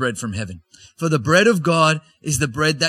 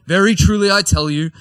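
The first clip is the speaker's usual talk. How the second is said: louder, higher ringing proclamation; emphatic declaration; 6 semitones higher, 7 dB louder than usual. Acted. Jesus answers the crowd, loud emphatic proclamation. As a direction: proclaim it with full force.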